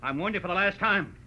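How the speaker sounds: loud, high, mounting threat; threatening warning. Acted. Gunman warns Dave he'll shoot, threatening. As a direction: build to a final shouted order.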